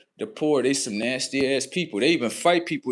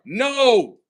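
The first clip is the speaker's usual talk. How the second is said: sharp, much higher, louder put-down; higher, louder scornful comeback; loud retort; 10 semitones higher, 6 dB louder than usual. Real, not acted. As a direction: shut them down hard. Ranting back at quoted complaint.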